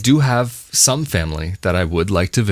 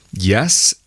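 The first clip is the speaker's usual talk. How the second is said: ordinary talk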